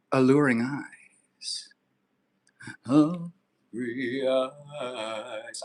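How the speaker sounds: whispered, slow sultry delivery; mock seductive. Answering a viewer about eyeliner, sultry whisper. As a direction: vamp it up for the chat. Flirty reply to chat.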